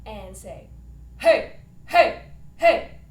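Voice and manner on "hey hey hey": strong grounded chest-voice heys, loud and full; full chest voice calls. Shown as a demonstration. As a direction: plant each hey in the chest. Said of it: "our strong foundation chest voice".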